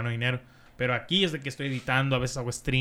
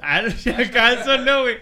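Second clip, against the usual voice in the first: laughing; 8 semitones higher, 10 dB louder than usual. Real, not acted. Cracking up at own joke.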